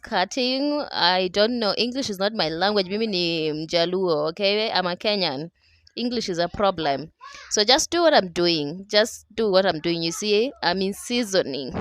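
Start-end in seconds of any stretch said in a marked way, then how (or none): none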